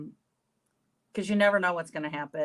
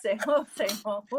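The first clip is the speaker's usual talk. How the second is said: laughing mock parent; 7 semitones higher, 5 dB louder than usual. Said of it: "sometimes it literally is".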